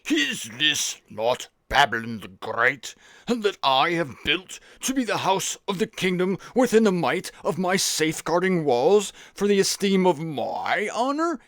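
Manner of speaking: put-on pompous Babylonian king, slow and drawn out; Nebuchadnezzar. Preacher playing King Nebuchadnezzar boasting of Babylon, pompous. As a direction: put on a grand, swooping Babylonian accent. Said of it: "this is how Nebuchadnezzar sounded".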